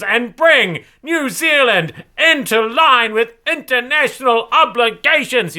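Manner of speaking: high, loud, slow triumphant shouting anchor; North Korean news lady. Shown as a demonstration. Host mocks a North Korean state news anchor, high loud shouting. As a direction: proclaim it with joyous state-TV fervour. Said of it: "I read it like the North Korean, uh, news lady".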